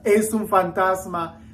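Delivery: normal talking voice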